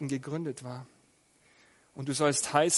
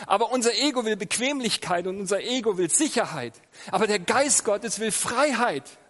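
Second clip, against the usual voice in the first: emphatic preaching; 5 semitones higher, 5 dB louder than usual. Real, not acted. Preacher driving home his point.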